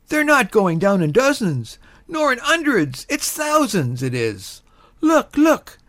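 high-pitched excited soldier's bellow. A narrator's reading. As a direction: marvel aloud to the man beside you, pitched high. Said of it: "lifted up his voice in the plainest English".

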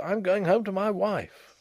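mildly frantic insistence. A narrator's reading. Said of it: "a little less frantic for his laughter".

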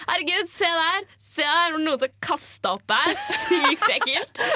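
high, exclaimed mock horror; feigned disgust. Student pointing out her own vomit to a friend, high feigned disgust.

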